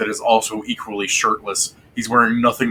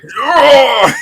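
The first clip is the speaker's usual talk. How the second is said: high, loud victory yell; triumphant shout; 10 dB louder than usual. Acted. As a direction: roar it like a strongman showing off. Dwarf lifts Rotnot, shows off.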